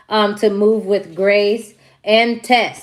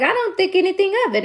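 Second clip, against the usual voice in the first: lightly voiced Lisa; 9 semitones higher, much faster than usual. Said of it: "And she was all like".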